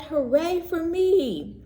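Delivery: high, slow proud cheer; duckling cheering itself on. A narrator's reading. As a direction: crow it with pride. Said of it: "he cried".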